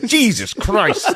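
high, drawn-out shocked imitation; hammed-up appalled exclamation; mock horror. Voicing dad's shock at the hand.

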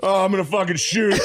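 high hammed-up cry; mock climax cry. Joining the joke with a high mock climax. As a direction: ham up the fake climax.

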